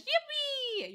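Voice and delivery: high, drawn-out cheer; amateur yippee. Pretend. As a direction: squeal a long yippee, a bit badly. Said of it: "I don't do the yippee that well".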